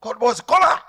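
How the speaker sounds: normal talking voice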